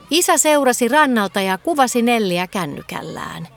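ordinary delivery

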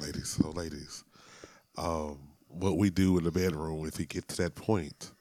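low, soft talk to the ladies; smooth seductive voice. Acted. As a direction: woo the listeners softly. Addresses listeners in low voice.

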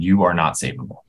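ordinary talk

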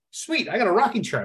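high-pitched, pleased discovery; mild delight. Retold or imitated. Man spots rocking chair on his new porch, mildly delighted.

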